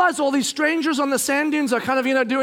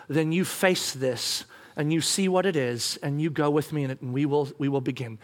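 soft, lower, tender assurance; gentle; 6 semitones lower, 4 dB quieter than usual. Retold or imitated. God reassuring husband about mission, gentle. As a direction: invite them quietly and lovingly. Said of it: "he just gently says to me".

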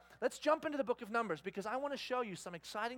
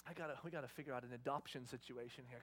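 low, soft, deadpan aside; muttered inner thought; 8 semitones lower, 12 dB quieter, faster than usual. Retold or imitated. Frustrated dad thinking to himself after tantrum, low muttering. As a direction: say it under your breath. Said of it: "I'm thinking to myself".

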